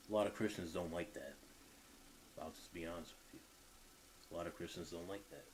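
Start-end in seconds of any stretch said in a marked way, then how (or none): 2.4-5.4 confiding aside. Real, not acted.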